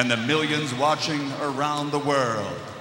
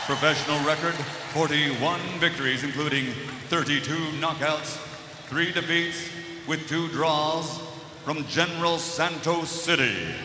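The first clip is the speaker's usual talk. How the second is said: boxing ring announcer; 4 semitones higher than usual. Acted. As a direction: rattle off the record, then explode on the name. Fighter introduction.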